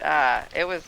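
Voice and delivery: ordinary talk, nothing marked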